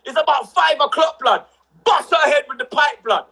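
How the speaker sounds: normal talking voice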